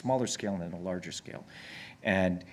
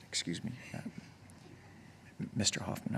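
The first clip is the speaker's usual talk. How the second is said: whispered, softer and hesitant; timid whisper; 10 dB quieter than usual. Retold or imitated. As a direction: approach him shyly under your breath. Timidly approaching famous actor.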